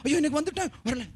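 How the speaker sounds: high mock whine; mock whining complaint. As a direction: imitate an impatient new believer's moan. Mimics an impatient new believer. (பொறுமையில்லாதவர் போல polyp புலம்பல்)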